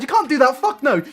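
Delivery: ordinary talk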